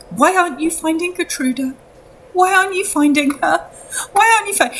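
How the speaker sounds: high-pitched, desperate pleading; distraught mother. Acted. Mother demanding again, cut off.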